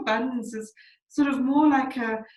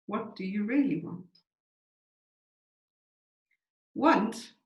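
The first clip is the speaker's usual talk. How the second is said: measured, contemplative poetry recital, letting each phrase land; unhurried verse with spacious pauses; slow poem reading. Real, not acted. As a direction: read slowly, with long silences between lines. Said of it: "I'm gonna read it quite slowly".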